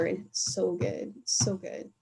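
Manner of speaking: so good, said softly, nearly whispered at the end; hushed savoring. Real, not acted. Raving to her co-host about a salad, hushed.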